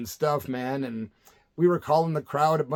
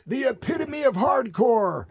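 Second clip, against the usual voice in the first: higher, slow mock crowd shout; audience shout; 8 semitones higher than usual. An audience member shouting praise, higher and slow. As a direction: holler it from the audience. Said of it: "some guy in the audience say".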